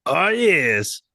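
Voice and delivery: high, put-on catchphrase voice; gloating Borat-style voice. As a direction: gloat like a comic foreigner, loud at first. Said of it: "the last words that he hears is".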